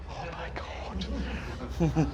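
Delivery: breathless, slow whispered dread; horrified whisper. Retold or imitated. Hearing the agent's threats, a slow horrified whisper. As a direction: whisper it, aghast.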